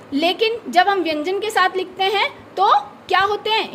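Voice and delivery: ordinary talk, nothing marked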